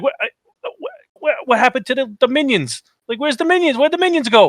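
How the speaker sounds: high-pitched, stammering bewilderment; hammed-up panicked confusion; mock freak-out. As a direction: imitate a guy tripping out, pitch jumping up. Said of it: "He started tripping".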